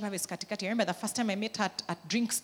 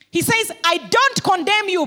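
much higher and louder, proclaimed; full-force preaching; 10 semitones higher, 11 dB louder than usual. Acted. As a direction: throw it out to the whole hall.

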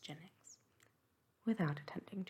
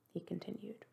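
ordinary delivery

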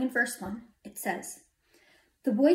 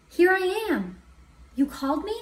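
raised, eager answer ending in a question; Samuel; 8 semitones higher, 4 dB louder than usual. A narrator's reading. Boy running to his master, eager answer. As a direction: report for duty, expectant.

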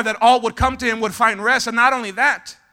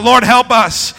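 loud congregational shout, much louder than usual; shouted plea; 2 semitones higher, 10 dB louder, slower than usual. Shown as a demonstration. Preacher leading the congregation in a plea, shouted. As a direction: shout it out for the whole room. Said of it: "Somebody shout".

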